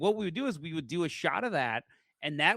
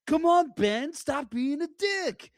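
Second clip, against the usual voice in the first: put-on drunk scolding; 12 semitones higher, 4 dB louder, slower than usual. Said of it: "And I'm just like".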